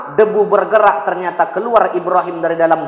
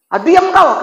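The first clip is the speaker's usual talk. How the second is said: high, loud shut up; angry silencing; 10 semitones higher, 5 dB louder than usual. Acted. King silences Ibrahim, angry shout. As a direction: cut him off furiously.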